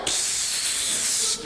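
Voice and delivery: a quick unvoiced psst for attention; whispered psst. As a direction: hiss a soft psst in his ear. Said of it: "Kinda like".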